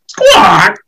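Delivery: loud high squawk; bird squawk. Acted. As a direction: squawk like a seagull. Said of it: "He says".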